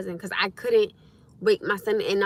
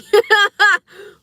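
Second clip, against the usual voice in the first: a big squealing burst of laughter, much louder and higher; 12 semitones higher, 13 dB louder than usual. Real, not acted. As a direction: let the laugh crack up high. Cracking up at own joke.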